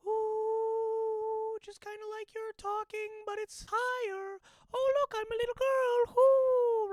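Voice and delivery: very high head voice; falsetto. Shown as a demonstration. Teaching fans the falsetto for Mickey. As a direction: float up into a girly falsetto. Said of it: "It's like".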